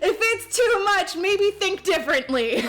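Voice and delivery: high, loud, through laughter; laughing. Real, not acted. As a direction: push the words out while laughing. Laughing while talking.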